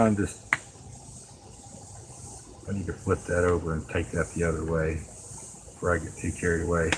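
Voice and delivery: ordinary talk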